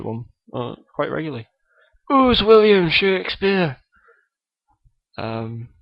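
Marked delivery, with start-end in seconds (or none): 2.1-3.8 higher, louder mock Scottish voice; a kid's hammed-up Scottish accent; Billy Connolly impression. Guest Billy Connolly greets the podcast host, mock Scottish accent. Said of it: "Billy Connolly is on the show".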